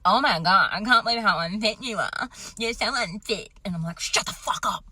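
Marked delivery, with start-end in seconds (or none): 0.1-3.5 loud, high taunting husband voice; husband teasing wife mid-run, loud and mocking. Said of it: "and he's like". 4.0-4.8 hissed, breathy angry snap; wife hissing back at husband, mock angry; angry retort. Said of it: "I just got so fucking angry".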